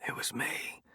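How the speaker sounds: unvoiced whisper in his ear. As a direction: breathe the confession as a secret whisper. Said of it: "Slingshot whispers in my ear".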